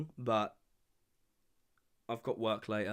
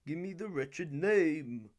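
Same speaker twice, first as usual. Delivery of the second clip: slow, higher-pitched theatrical demand; imitated stern father; 5 semitones higher, slower than usual. Podcaster mimicking a film's trial scene, slow theatrical demand. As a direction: draw it out in a put-on, imperious voice.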